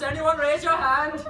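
normal talking voice

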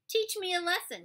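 high, taunting dare; cocky challenge; challenging. A narrator's reading. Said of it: "he challenged".

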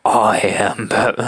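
slow superhero growl; Batman. Acted. Another failed go at the superhero, Batman voice. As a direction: struggle for a gravelly hero voice. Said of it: "I can't do the Batman voice".

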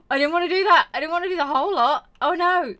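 loud, rushed oh-no panic; real gamer dismay; dismayed outburst. Real, not acted. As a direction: blurt it out in frustration. Game mistake, frustrated outcry.

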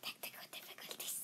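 fast, breathy whispered apology; whispering. Real, not acted. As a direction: whisper it quickly, half laughing. Host whispers apology while laughing.

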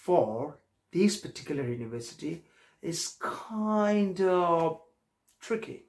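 slow, measured model English; example sentences. Shown as a demonstration. Teacher applying the word in sentences, slow and deliberate. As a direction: space the words out slowly for learners. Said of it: "আমরা ঠিক এইভাবে বলবো".

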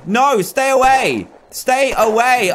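high, drawn-out stay away; begging the zombie off; panicked pleading. Real, not acted. Gamer pleading with approaching zombies, panicked.

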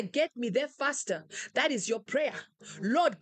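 ordinary talk

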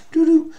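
high two-note electronic chime imitation; Siri chime. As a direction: sing a little phone beep. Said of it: "that's the Siri sound".

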